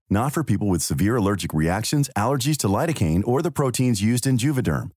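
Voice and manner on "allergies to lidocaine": ordinary talk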